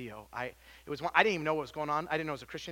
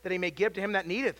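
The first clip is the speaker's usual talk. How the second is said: loud, raised preaching read; preaching scripture; 2 semitones higher, 6 dB louder than usual. Acted. Preacher reading scripture aloud to church, loud. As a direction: proclaim the verse to the congregation.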